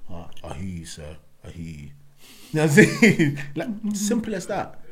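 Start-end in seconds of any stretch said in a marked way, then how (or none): 0.1-1.9 low quiet sheepish voice; humbled inmate. Retold or imitated. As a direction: mumble it, beaten. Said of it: "he's like".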